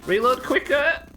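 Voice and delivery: 2 high loud shout, slow and drawn out; 3 stretch out the shout in frustration; 1 frustrated yell. Real, not acted. 4 gamer losing a fight yelling at himself, slow frustrated shout.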